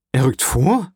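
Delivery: startled king reacting to bad news; exclaimed question. A narrator's reading. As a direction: exclaim it in disbelief, pitch jumping up at the end. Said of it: "rief Ludwig der Achtzehnte".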